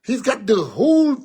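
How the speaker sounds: ordinary talk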